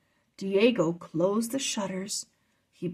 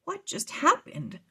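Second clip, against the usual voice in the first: put-on startled boy; 9 semitones higher than usual. Drake startled by magic.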